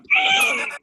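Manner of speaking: sudden high chuckle; high laugh. Real, not acted. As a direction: break into a short laugh. Laughing at himself.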